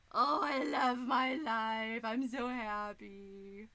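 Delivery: slow, drawn-out bliss; blissful. Real, not acted. Streamer to chat, slow and blissful. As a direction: savor every word. Blissful over new setup.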